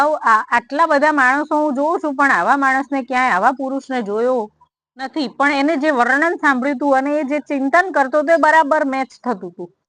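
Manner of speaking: usual speaking voice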